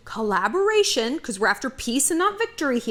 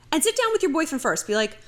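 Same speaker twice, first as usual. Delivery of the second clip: ordinary talk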